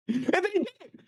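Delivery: high-pitched outburst, voice way up; worked-up yelling. Real, not acted. Co-host recounts the birthday salute, yelling high. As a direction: yell it in incredulous excitement. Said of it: "if you're gonna get this loud".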